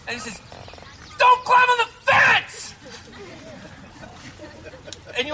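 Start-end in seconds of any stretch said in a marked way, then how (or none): 1.2-2.7 high, loud, drawn-out dad yell; dad's booming shout. Acted. Dad yelling at kids on the fence, loud stern shout. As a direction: shout it as a father's command, every word stretched. Acting dad who steps in.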